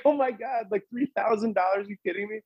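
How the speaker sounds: high, laughing disbelief over the money; retelling the moment with his mom, laughing and thrilled; overjoyed disbelief. Retold or imitated. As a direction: squeal it out, laughing and stunned. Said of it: "we both were, like, crying".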